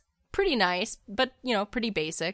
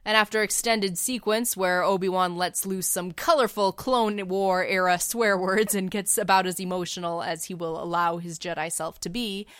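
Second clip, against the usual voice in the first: ordinary delivery, nothing marked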